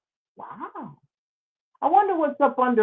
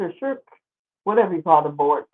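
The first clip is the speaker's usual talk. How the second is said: normal talking voice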